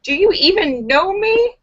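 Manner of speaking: high, mock-outraged "do you even know me"; mock indignant shout. Gift receiver protests to the giver, high and indignant. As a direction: fake offence at a bad gift. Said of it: "you involuntarily shout out".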